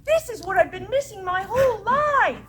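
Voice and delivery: transformed witch delighted by warm fuzzies, lightly British. Acted. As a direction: gush in a lightly British accent. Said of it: "soft, lovely British voice".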